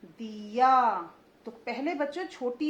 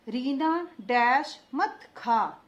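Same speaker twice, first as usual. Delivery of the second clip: slow, louder, every syllable clear; slow dictation; 5 dB louder, much slower than usual. Shown as a demonstration. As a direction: dictate it slowly to the class. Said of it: "देखते जाइए मैं कैसे उच्चारण कर रही हूँ".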